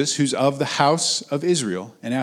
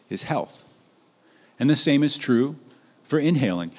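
ordinary talk

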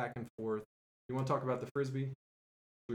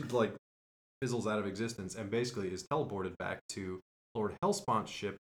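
ordinary talk, nothing marked

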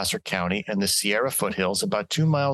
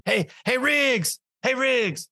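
high-pitched movie quote yell; Lethal Weapon call-out; 13 semitones higher, much slower than usual. Acted. Host quoting an action movie, high-pitched calling out. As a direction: call out for your partner, voice way up. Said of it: "straight out of Lethal Weapon".

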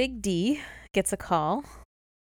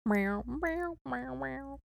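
meowing; 7 semitones higher, 5 dB quieter, much slower than usual. Pretend. Singing cat-sound lyrics.

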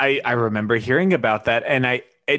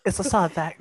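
higher-pitched attempt at a British accent; 6 semitones higher, faster than usual. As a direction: try British once more, on the edge of laughter. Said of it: "read this in a British accent".

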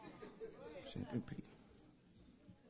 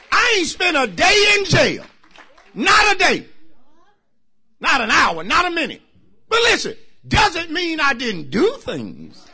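louder, higher preaching shout; full-force preaching; 10 semitones higher, 8 dB louder than usual. Acted. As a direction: preach it hard to the congregation.

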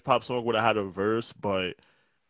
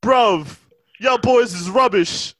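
loud, higher, drawn-out London roadman shout; mock British gangster; 8 semitones higher, 5 dB louder, slower than usual. Mocking UK rappers in a loud British gangster voice. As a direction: bark it like a hard UK rapper.

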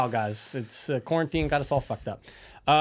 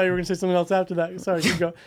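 laughing; 5 semitones higher, faster than usual. Real, not acted. Laughing at misunderstanding.

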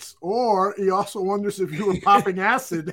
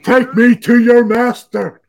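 alien voice; 12 dB louder than usual. Pretend. Mock alien line, podcast banter.

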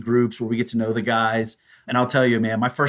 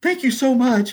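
teary thanks; 11 semitones higher than usual. Retold or imitated. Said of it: "I'm sitting there crying".

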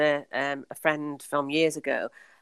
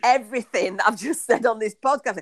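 rushing, excited and higher, piling it on; gushing on and on; 6 semitones higher, 4 dB louder than usual. Retold or imitated. To a friend on the phone, breathless excited gushing. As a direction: tumble it out like an overexcited fan. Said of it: "I just went on and on and on".

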